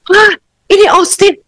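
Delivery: can't believe it's him; thrilled mother. Retold or imitated. Mother on the phone with her son, high thrilled disbelief.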